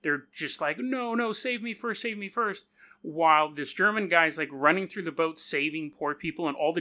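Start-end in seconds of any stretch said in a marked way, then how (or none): 0.8-2.7 higher-pitched mock panic; lightly hammed-up coward's plea; mock cowardly. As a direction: mimic cowards pleading to be saved first. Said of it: "like, totally cowardly".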